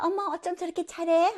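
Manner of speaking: mildly hammed-up, high-pitched wonder; acted admiration. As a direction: play a classmate marvelling. Voices classmates admiring a presenter.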